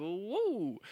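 drawn-out loud wow; amazed wow. Real, not acted. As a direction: swoop up on a long wow. Sudden exclamation of amazement.

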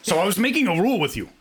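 put-on French accent. Co-host making a new rule, badly faked French accent. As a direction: spell out terms in muddled French-Russian accent. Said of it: "you sound like a Frenchman".

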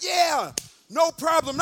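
high, loud, drawn-out yeah; eager agreement. Retold or imitated. Young man agreeing to pay, loud and eager.

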